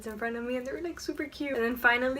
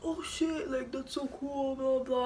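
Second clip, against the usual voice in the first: high guy; 4 semitones higher than usual. Retold or imitated. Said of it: "he was like".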